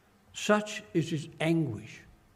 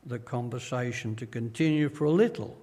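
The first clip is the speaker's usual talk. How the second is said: ordinary talk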